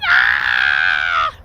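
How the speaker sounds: low, strangled mock scream of a fleeing crowd; imitated crowd scream. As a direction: let out a quick panicked cry. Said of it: "People running, screaming".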